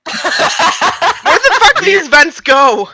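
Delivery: loud, high, drawn-out yell; big baffled holler; exasperated shout. Acted. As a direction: shout it slowly in bewildered exasperation. Acted outburst about the vents.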